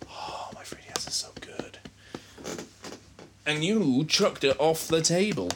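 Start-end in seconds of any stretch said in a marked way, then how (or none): 0.1-1.8 unvoiced whisper. Real, not acted. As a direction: whisper it sadly to yourself. Whispered aside.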